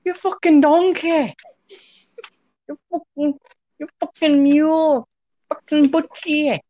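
high, loud hammed-up insults; mock angry rant. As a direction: spit out insults like a theatrically furious TV chef. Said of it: "talking that way to other people whenever you get mad".

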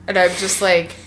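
normal talking voice

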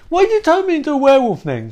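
indignant. Retold or imitated. Bob indignantly questioning Eve, high and fast. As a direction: blurt it in disbelief.

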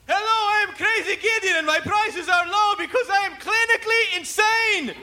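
a TV salesman going mad; unhinged pitchman. Pretend. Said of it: "come out and go".

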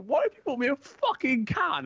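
high, mock-outraged whine at a friend; mock fuming. Teasing mate about his can, high mock outrage. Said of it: "come back fuming".

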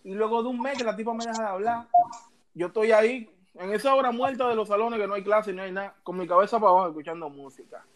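usual speaking voice

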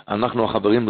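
normal talking voice

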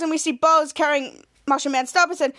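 usual speaking voice

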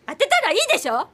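shrill-free defiant challenge; defiant dare. Acted. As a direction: dare him to strike. Wife dares him to hit.

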